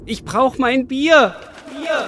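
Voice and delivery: craving man crying out for beer; desperate cry. Acted. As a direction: beg the sky for your beer. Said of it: "Ich, ich brauch mein Bier".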